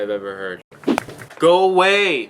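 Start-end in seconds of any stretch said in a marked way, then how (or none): 1.4-2.3 shouting. Real, not acted. Shouting them off.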